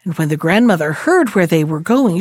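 usual speaking voice

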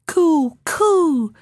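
high birdlike coo; pigeon cooing. Acted. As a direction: coo it like a bird. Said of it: "said the wood pigeons".

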